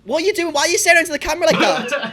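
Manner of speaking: laughing, incredulous yelp at his stare; incredulous outburst. Real, not acted. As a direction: yelp it at the screen in disbelief. Reacting to staring man.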